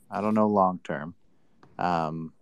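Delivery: ordinary delivery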